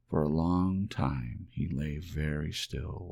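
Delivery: ordinary delivery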